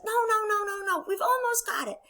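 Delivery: high, insistent coaching frog; urgent, peppy storybook instructions; Frog character voice. Acted. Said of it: "And Frog says".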